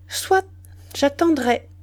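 husband masking his disappointment, lightly cheerful; with gaiety. A narrator's reading. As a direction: answer cheerfully with a lift. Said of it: "dit-il avec gaieté".

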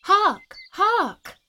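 high, loud, eager cries; excited girl. Acted. Little girl spots the nightingale, calls out high and eager. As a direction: point out the bird in delight. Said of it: "said the little girl".

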